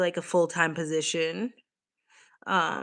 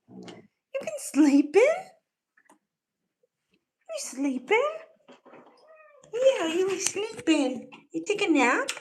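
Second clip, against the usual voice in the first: high, slow sing-song pet voice; 13 semitones higher than usual. Real, not acted. Talking sweetly to the sleepy cat, high baby talk. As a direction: coo at a sleepy kitten.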